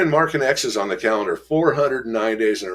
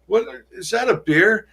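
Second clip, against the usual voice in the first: much higher, startled dude; shocked disbelief; 12 semitones higher than usual. Retold or imitated. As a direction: blurt it out in disbelief. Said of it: "I'm like".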